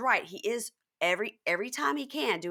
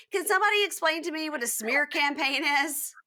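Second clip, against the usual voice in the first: pitched-up, puzzled voicing of a cast member; mock confused Danielle; 8 semitones higher, 3 dB louder than usual. Mimicking Danielle asking the reunion what a smear campaign is, high and puzzled. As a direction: play her as lost and asking the room.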